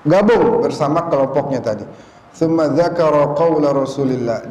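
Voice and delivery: ordinary talk, nothing marked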